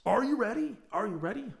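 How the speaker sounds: high rising hype question; excited. Real, not acted. Host rallying listeners before the episode. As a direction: pump up the audience.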